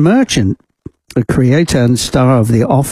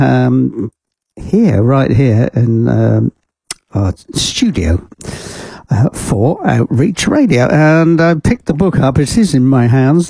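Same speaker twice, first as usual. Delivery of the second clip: usual speaking voice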